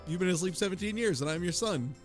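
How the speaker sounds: ordinary talk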